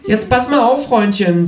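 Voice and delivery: high, loud cocky threat; menacing kangaroo. Acted. Kangaroo confronting Freundlich on TV, high loud cocky voice. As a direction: square up as the cheeky kangaroo. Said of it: "sagt das Känguru im Fernsehen".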